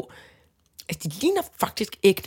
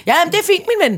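friendly shopkeeper; 8 semitones higher, 3 dB louder, faster than usual. Retold or imitated. Shopkeeper answering the child.

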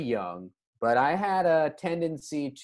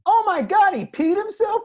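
very high, loud fake-shocked taunt; mocking accusation; 8 dB louder than usual. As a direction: loudly turn the blame on another kid. Speaker mocks the other kid.

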